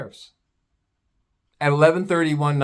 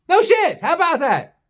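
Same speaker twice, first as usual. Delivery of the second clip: high and louder gloating; mock amazement; 11 semitones higher, 3 dB louder than usual. Real, not acted. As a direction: relish the news, high and loud. Gloating at neighbor leaving.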